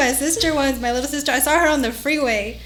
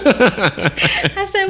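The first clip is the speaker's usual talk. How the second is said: ordinary talk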